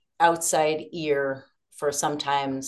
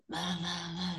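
quiet mumble; 13 dB quieter than usual. Retold or imitated. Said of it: "'cause I'm trying to be quiet and I'm half asleep".

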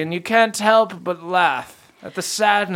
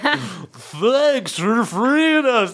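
slow bee man voice; 5 semitones higher, much slower than usual. Acted. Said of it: "they're just like".

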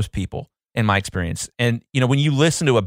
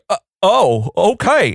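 high Southern voice, dawning interest; slow-witted Southerner catching on; dumb Southern accent; 8 semitones higher, 4 dB louder, slower than usual. Acted. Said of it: "make them sound so dumb with the Southern accent".

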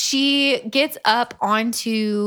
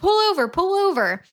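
urgent demand; 9 semitones higher, faster than usual. Retold or imitated. Girlfriend demanding he stop car.

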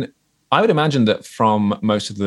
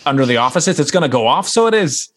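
high, hammed-up Northern Irish caller; mock Northern Irish bomb warning; fake Irish accent; 6 semitones higher, 3 dB louder than usual. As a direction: put on a lilting Northern Irish accent for a hoax call. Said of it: "like, a Northern Irish accent".

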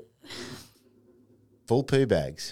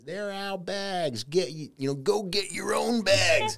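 a slow, higher mock of a grumpy neighbour; imitating the neighbour; 7 semitones higher, 4 dB quieter, slower than usual. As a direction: drag it out like a petty neighbour. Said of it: "He goes".

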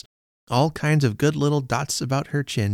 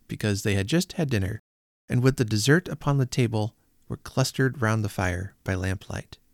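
ordinary delivery

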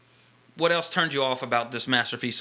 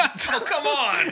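slow, high, laughing "oh come on"; laughing protest; 10 semitones higher, much slower than usual. Real, not acted. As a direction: draw it out in amused disbelief. Teasing protest at wife's confession.

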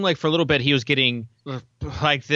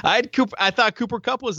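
laughing shout; 10 semitones higher than usual. Real, not acted. Laughs at own bad pick.

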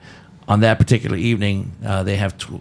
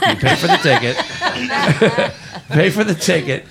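laughing; 7 semitones higher than usual. Real, not acted. Laughing jab at one-star reviewer.

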